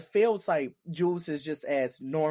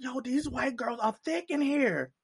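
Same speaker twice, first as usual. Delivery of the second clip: impressed disbelief; 9 semitones higher than usual. Retold or imitated. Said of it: "I was like".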